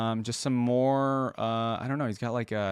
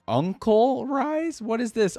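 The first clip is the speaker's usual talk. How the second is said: incredulous surprise; 13 semitones higher, 5 dB louder, slower than usual. Real, not acted. Reacting to an odd skin.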